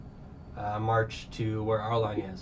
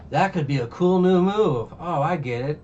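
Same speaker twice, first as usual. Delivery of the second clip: high, louder put-on eureka; mock dawning realization in a raised voice; naive realization; 6 semitones higher, 6 dB louder than usual. Luffy thinks over the pinwheel game, naively excited. Acting out character pondering game.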